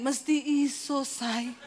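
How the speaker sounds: the husband quietly insisting; husband's soft firm voice. Retold or imitated.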